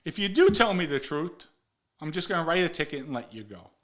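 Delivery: ordinary talk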